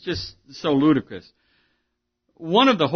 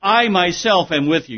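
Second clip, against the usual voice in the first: I MYSELF hit firmly, higher and louder; stress on I MYSELF; 4 semitones higher, 4 dB louder than usual. Shown as a demonstration. Preacher paraphrasing the Greek into English, emphatic stress on I MYSELF. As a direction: punch I MYSELF, then finish the phrase. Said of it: "You see the emphasis".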